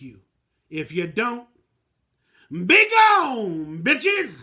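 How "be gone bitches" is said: loud, higher shout of be gone, bitches; loud dismissal. Real, not acted. Fan loudly telling the haters to get lost. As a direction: throw the haters out with a yell.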